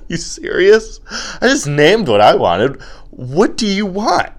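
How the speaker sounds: high, loud incredulous protest; exasperated disbelief. Acted. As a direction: play fed up, pitch jumping up. Incredulous acted argument with girlfriend.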